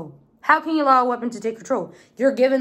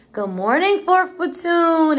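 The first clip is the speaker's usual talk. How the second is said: greeting that climbs high and stretches at the end; platoon greeting call; 8 semitones higher, 5 dB louder, slower than usual. Retold or imitated. Platoon mom greeting everyone, called out. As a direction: sing out a drawn-out, louder greeting.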